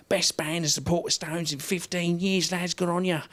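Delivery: cheerful English roadie praising the lads; English accent. Retold or imitated. Roadies clapping the band after the gig, in an English accent. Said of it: "That's the English accent".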